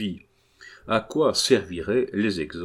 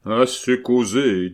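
slow, louder cut-off command; mild menacing bass; 3 semitones higher, 6 dB louder, slower than usual. A narrator's reading. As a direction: shut the talk down firmly, lightly acted. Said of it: "d'une voix de basse effrayante".

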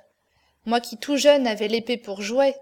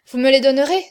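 a sudden cry, much louder; eager outcry; 3 semitones higher, 9 dB louder, faster than usual. A narrator's reading. Princess crying out to Lagardère for the proofs. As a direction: burst out with desperate hope. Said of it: "S'écria la princesse".

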